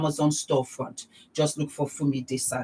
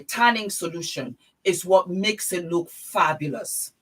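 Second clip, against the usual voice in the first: ordinary delivery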